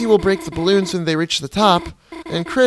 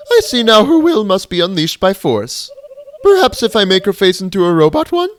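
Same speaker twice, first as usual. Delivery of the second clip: loud, high, haughty queen; regal villain voice; 5 semitones higher, 6 dB louder than usual. Acted. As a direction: gloat like a grand robot monarch. Queen scheming.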